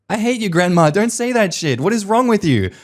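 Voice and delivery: high indignant yell at grandma; mock outraged retort. Grandchild snapping at grandma, high and outraged. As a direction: fire it back, pitched high and appalled. Kid yelling back at grandma.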